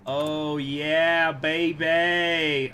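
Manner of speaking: loud gleeful celebration; excited cheer. Real, not acted. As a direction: whoop it up. Celebrating a win.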